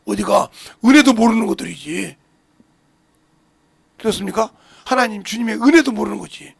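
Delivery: ordinary delivery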